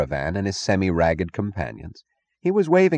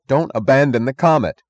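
higher, louder pleading cry; desperate cry; 7 semitones higher, 6 dB louder than usual. Acted. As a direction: cry out to stop them. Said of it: "cried Eddie Willers".

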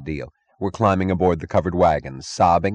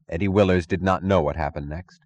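ordinary delivery, nothing marked

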